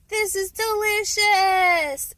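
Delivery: loud, high, slow gleeful dog voice; happy dog voice. Retold or imitated. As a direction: gush like a dog in heaven. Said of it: "The dog is like".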